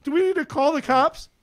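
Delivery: high, loud mock bewilderment; alarmed bystander. Passersby at the parking structure hearing the yelling, mock alarm. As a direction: imitate a baffled passerby raising their voice. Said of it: "There's people walking to classes saying".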